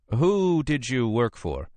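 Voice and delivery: high, slowed lawyer's voice; Brisbane questioning. Acted.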